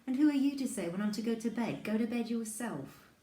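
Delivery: put-on loud, high, cross child; indignant retort. Bad-tempered boy tells sister off over bedtime, indignant.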